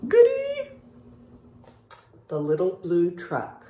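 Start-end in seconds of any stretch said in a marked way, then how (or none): 0.1-0.7 much higher gleeful "Goody!"; excited squeal. Real, not acted. Teacher cheering to kids before the story. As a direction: squeal with delight.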